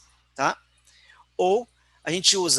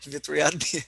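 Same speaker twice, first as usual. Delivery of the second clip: laughing. Real, not acted. Laughing at own joke.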